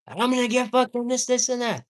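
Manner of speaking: very high, fast, loud hyped customer; hyped-up order. Pretend. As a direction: order like you can't wait to eat. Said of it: "hyping up in front of the fucking employee".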